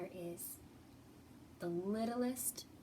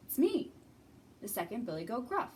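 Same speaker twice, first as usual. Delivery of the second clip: ordinary delivery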